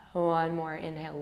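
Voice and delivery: ordinary delivery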